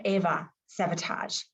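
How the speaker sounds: ordinary talk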